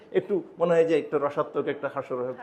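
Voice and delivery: ordinary talk, nothing marked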